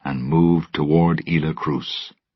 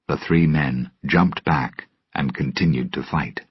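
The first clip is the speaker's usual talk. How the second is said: ordinary delivery, nothing marked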